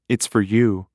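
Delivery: wry, teasing It's for you with stress on you; wry wife teasing her husband about the call. Acted. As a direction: hand him the phone with a knowing smirk. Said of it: "wrenched a diagonal smile".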